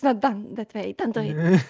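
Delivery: lightly voiced scolding mother; a hint of a stern elder; mock parental warning.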